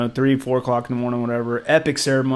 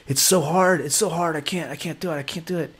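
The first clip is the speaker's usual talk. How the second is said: soft, higher, fading plea of someone who can't cope; imitating desperation; 4 semitones higher, 4 dB quieter than usual. To the shaman in the ceremony, a retold despairing plea. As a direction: whimper the words out, growing softer. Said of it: "I was like".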